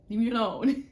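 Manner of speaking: loud mock yell to be left alone; playful shout. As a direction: belt it out, half joking. Half-joking plea to be left alone.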